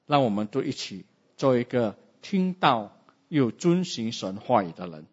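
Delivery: ordinary talk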